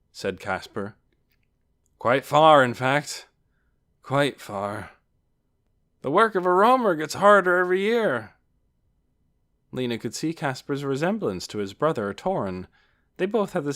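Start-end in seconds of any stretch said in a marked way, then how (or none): 2.0-8.3 loud, raised voice, dropping on the repeat; hearty traveller. A narrator's reading. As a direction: hold forth grandly about a hard job. Casper explains his long trip.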